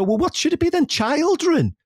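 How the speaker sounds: put-on high-pitched retort, "Children" over-pronounced; mock exasperated overcorrection. Arguing back at Becca, mock overcorrecting "Children". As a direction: protest high, stretching the first syllable of "Children". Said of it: "I go".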